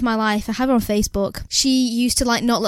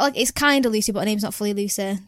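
normal talking voice

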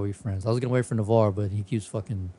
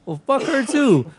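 mock defiant; 5 dB louder than usual. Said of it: "I was like".

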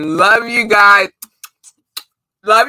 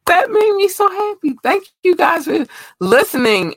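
happy high thanks; 12 semitones higher than usual. Real, not acted.

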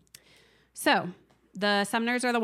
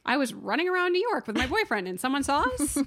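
mock panicked Connie; 7 semitones higher, 4 dB louder than usual. Voicing Connie's panic mockingly.

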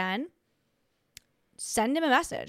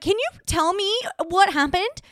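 excited retold question; 9 semitones higher, 6 dB louder than usual. Retold message to girl.

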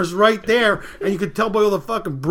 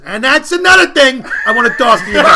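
loud, raised-pitch mock yell; quarrelling husband; 6 semitones higher, 13 dB louder than usual. Husband carrying on at his wife, loud mock anger. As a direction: shout the comeback as a punchline.

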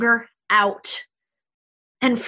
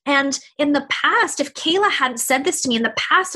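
ordinary talk

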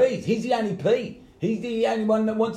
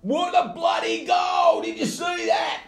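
a high, slow, thrilled shout about the goal; excited shout; 11 semitones higher, slower than usual. Real, not acted. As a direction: cheer it out like a fan.

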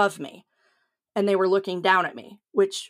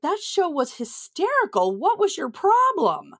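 high, incredulous mock disbelief; ribbing her past self, pitched up and slower at the end; baffled rewatching own show; 9 semitones higher than usual. Comic watching her old set, incredulous and pitched up. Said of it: "and I'm like".

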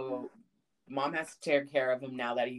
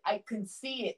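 usual speaking voice

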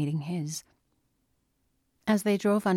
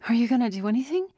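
higher, louder and fast; demanding; 6 semitones higher, 4 dB louder, much faster than usual. A narrator's reading. Daughter demanding her father act, urgent and fast. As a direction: press him hard, urgent.

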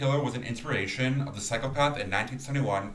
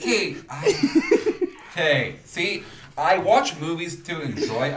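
laughing; 6 semitones higher, 5 dB louder, much slower than usual. Real, not acted. Laughing defensive.

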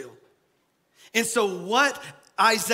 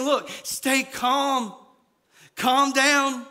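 slow, higher, louder preaching plea; fervent comfort; 5 semitones higher, 4 dB louder, much slower than usual. Acted. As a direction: preach calm with force.

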